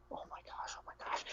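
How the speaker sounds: barely audible, frightened whisper; scared whisper. Acted. Gnomes learning Falika is dead, scared whisper. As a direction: whisper it to yourself in alarm. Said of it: "kinda scare themselves".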